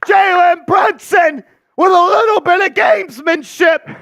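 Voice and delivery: loud high excited call; excited shout. Real, not acted. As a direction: yell the foul call. Offensive foul called loudly.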